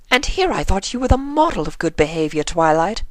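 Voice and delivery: high, mildly surprised lady. A narrator's reading. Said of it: "Rarity said with some surprise".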